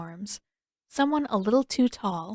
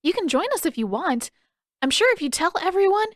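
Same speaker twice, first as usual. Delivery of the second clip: eager invitation; 10 semitones higher, 4 dB louder, faster than usual. Acted.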